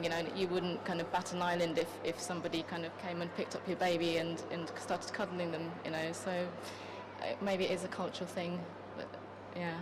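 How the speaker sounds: normal talking voice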